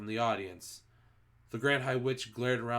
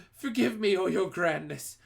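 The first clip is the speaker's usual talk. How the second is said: much higher, louder begging; pleading cry; 8 semitones higher, 5 dB louder than usual. A narrator's reading. Culprit begging the Grand High Witch, high loud pleading. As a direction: beg for forgiveness. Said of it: "cried the miserable culprit".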